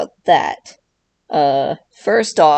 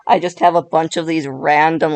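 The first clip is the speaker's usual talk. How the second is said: usual speaking voice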